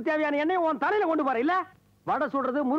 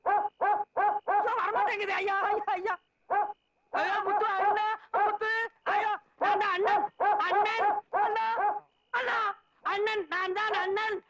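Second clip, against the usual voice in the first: high-pitched, frantic pleading; yelping in mounting panic; comic panic; 5 semitones higher than usual. Acted. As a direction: plead desperately with an attacking dog. Stuck mask, dog attacking him.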